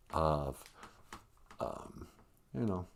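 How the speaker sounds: ordinary talk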